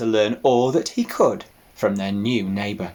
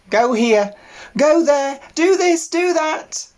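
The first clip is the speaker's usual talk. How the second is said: very high, loud, frantic piglet orders; furious squeak; 5 dB louder than usual. Acted. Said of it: "he squeaked furiously at them".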